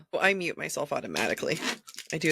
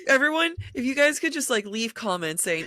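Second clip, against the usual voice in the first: laughing; 6 semitones higher than usual. Real, not acted. Asking listeners while laughing.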